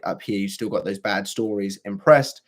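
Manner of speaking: ordinary delivery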